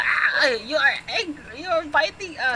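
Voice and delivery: loud playful cry of alarm; mock alarmed shout. Parent yelling playfully at the pig puppet, mock alarm. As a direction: ham up the fright, loud.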